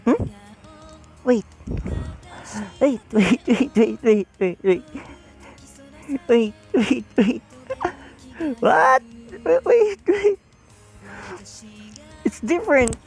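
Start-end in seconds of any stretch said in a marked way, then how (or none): none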